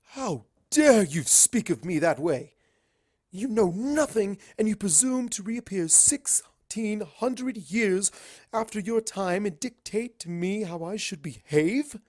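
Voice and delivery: loud, slow, trembling fury breaking to a hiss; furious Luna. A narrator's reading. Luna furious at Starswirl's lecture, slow loud rage. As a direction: Luna rages then hisses the rest. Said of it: "Luna asks".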